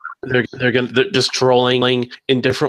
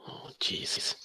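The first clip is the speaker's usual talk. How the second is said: breathy frustrated whisper under the breath; exasperated whisper; 17 dB quieter, slower than usual. Real, not acted. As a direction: sigh it out as a whisper. Echo problem, exasperated aside.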